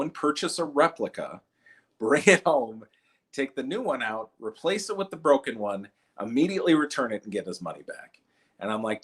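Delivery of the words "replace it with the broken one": usual speaking voice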